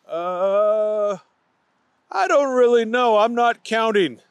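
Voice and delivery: sheepish candy eater; evasive Booger. A narrator's reading. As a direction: dodge the question. Booger evades.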